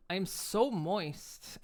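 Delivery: slow, drawn-out playful purr; slow hammed-up sultry line; mock sultry. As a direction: stretch it out teasingly. Joking about the model's sheen.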